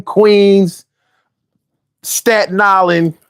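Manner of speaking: normal talking voice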